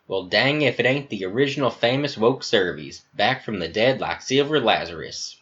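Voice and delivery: put-on soldier's Southern accent; drawled American South greeting. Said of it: "He has an American Southern accent".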